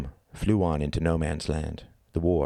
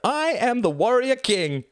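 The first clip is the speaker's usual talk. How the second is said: high, drawn-out victory cry; triumphant crowing; 4 dB louder, slower than usual. A narrator's reading. Tom pumps his arms after winning, triumphant cry. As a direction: proclaim it slowly, high and triumphant.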